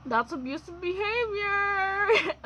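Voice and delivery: real tears catching in the throat; voice breaking. Real, not acted. Admits ex's abuse, voice cracks.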